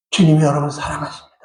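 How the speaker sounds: hushed, low and quick; tender reassurance. Real, not acted. Preacher assuring the congregation of God's love, tenderly hushed. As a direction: drop to a soft, low assurance.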